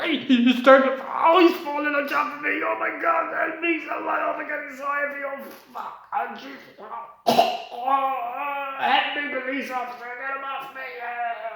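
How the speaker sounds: high, loud cries for help; panicked screaming. Acted. Man crushed under a falling giant calls for help, panicked screaming. Acting out being crushed by Andre.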